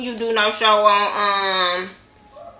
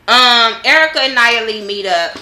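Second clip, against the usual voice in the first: ordinary delivery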